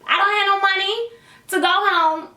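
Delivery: high, pleading voice, heart racing. Retold or imitated. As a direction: beg him for a ride home. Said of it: "because I'm like".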